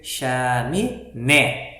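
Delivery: "chaminé" slowly, correctly landing hard on NÉ; slow syllables, weight on the final "né" of "chaminé"; stress on last syllable. Shown as a demonstration. Teacher giving the correct reading, stressed last syllable. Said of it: "acento na última sílaba".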